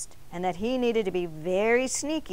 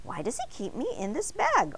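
donut voice; 6 semitones higher than usual. A narrator's reading. Arnie's voice in story.

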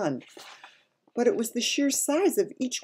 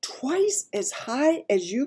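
high, slow, amazed question; astonished cry; 8 semitones higher, slower than usual. A narrator's reading. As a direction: ask in amazement as a little girl. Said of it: "cried Sophie".

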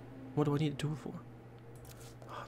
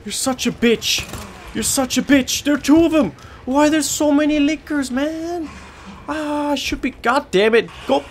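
loud, high angry shouting; real frustrated shouting; furious yell; 14 semitones higher, 7 dB louder than usual. Real, not acted. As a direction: yell at the monsters. Yelling at lickers.

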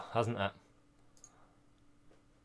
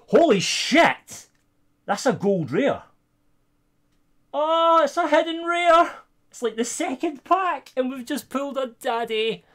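much higher and louder, stretched-out disbelief at the pull; shocked delight; 14 semitones higher, 6 dB louder than usual. Real, not acted. Streamer pulls a hidden rare in the second pack, shocked delight. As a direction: blurt it out, floored by the card.

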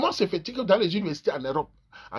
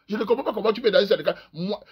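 normal talking voice

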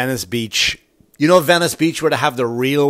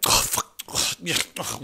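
breathy under-the-breath oh fuck; a voiceless little groan of annoyance; whispered curse; 4 semitones higher, 8 dB quieter than usual. Retold or imitated. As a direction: say it to yourself as you jog past. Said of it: "One of those, right?".

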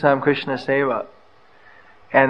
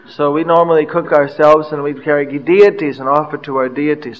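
usual speaking voice

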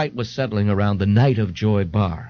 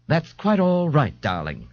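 angry; 6 semitones higher than usual. A narrator's reading. A young man answers a customer's mother, mildly angry. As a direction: brush it off with restrained annoyance. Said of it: "the young man said angrily".

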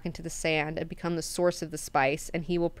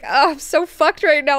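panicked servant; 8 semitones higher, 9 dB louder than usual. Retold or imitated. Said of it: "Mineo's just like".

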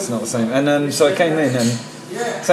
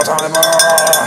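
drawn out call; 4 semitones higher, slower than usual. Pretend.

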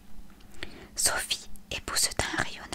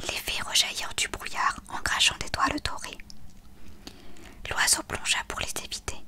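plain reading voice

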